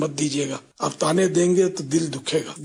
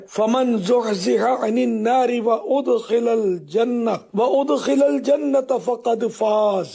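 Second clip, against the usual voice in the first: slow chanted Arabic, pitched higher; Quran recitation; 6 semitones higher, much slower than usual. Acted. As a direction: chant the verse slowly and melodically.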